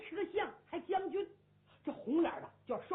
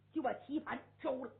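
ordinary delivery, nothing marked

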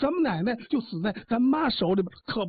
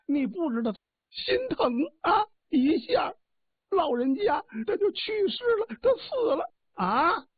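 high, wailing put-on sobbing, much higher; fake crying; 10 semitones higher than usual. Wife fakes tears telling husband his mother died, wailing.

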